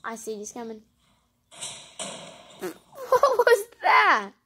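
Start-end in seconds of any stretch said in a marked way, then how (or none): 3.1-4.3 surprised. Real, not acted. Loud slow shocked reaction.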